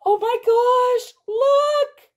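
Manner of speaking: high, loud squeal of delight; gasp, then squeal it out; breathless gasp into a high squeal. Real, not acted. First advent surprise revealed.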